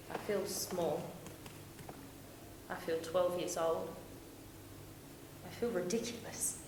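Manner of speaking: quiet, low, deflated voice; soft, humiliated hurt; small and crushed. Acted. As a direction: shrink into each short admission. Abandoned, feels small and ashamed.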